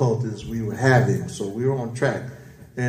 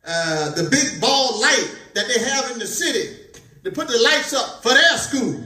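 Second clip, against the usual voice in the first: high-pitched, fired-up sermon voice; excited preaching; 10 semitones higher than usual. Real, not acted. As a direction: preach it carried away by the spirit. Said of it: "apologize for getting a little excited".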